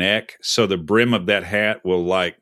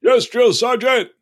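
high, slow shouted response; barked reply; 10 semitones higher, slower than usual. Pretend. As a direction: bark it out like a recruit. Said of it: "What are you gonna say?".

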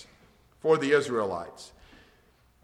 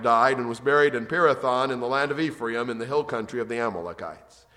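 louder, measured reading of the Bible text; scripture reading; 10 dB louder than usual. Acted. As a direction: read the verses out to the congregation. Reads Judges passage aloud.

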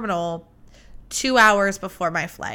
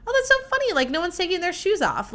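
high, amused, half-laughing aside; amused surprise; 8 semitones higher, faster than usual. Retold or imitated. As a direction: pitch it up like you just noticed something odd. Retold amused remark in security line.

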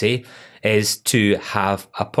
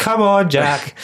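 protesting; 10 semitones higher, 4 dB louder, slower than usual. Real, not acted. Mock protest at teasing.

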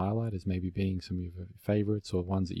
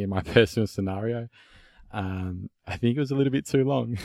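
laughing; 4 semitones higher, 6 dB louder than usual. Real, not acted. Laughing at own long stay.

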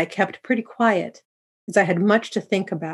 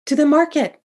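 high, louder frightened reply; trembling with fear; 9 semitones higher, 5 dB louder than usual. A narrator's reading. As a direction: answer the robber in fear, pitched well up. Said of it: "his words trembling with fear".

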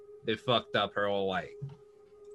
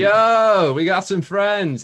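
loud, high holler; big raised-voice greeting; calling out; 8 semitones higher, 8 dB louder, slower than usual. Acted. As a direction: shout the news to the whole gang. Character announces newcomers to his gang.

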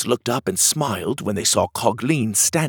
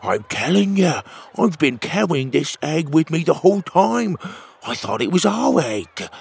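higher, put-on platypus voice; raised, earnest character voice; protesting Petey; 6 semitones higher than usual. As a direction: insist on your innocence to the family. Voices Petey protesting about egg.

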